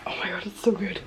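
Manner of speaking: breathy awed whisper. Real, not acted. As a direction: whisper it in awe. Admiring new t-shirt.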